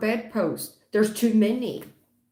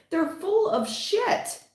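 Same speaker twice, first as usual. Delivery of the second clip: scoffing; 12 semitones higher, slower than usual. Real, not acted. Reader ranting about the ex.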